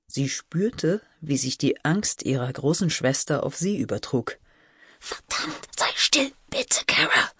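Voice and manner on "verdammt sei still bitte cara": tense, urgent whispered plea; pleading whisper. A narrator's reading. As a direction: beg her to hush, whispering. Frightened sister begs for silence. (flehendes, angespanntes Flüstern)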